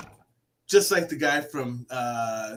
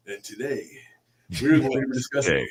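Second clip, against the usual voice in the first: mock narrator voice; 6 semitones lower than usual. Guest showing that voice audiobook listeners like, low narrator imitation. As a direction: drop low and hushed for a documentary intro. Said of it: "it's just that voice where people are like".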